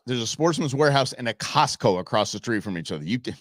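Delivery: ordinary talk, nothing marked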